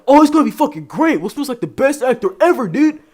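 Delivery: very high, loud gushing; hammed-up gushing fan; mock fanboy. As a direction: gush like an overexcited fan. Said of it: "a lot of people are like".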